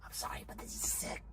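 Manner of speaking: hushed excited whisper. Real, not acted. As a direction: whisper so no one hears. Quiet excitement inside freezer.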